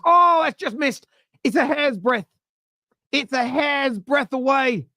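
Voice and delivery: high, drawn-out cry over a near miss; match commentary. Real, not acted. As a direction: soar up as a commentator reliving the chance.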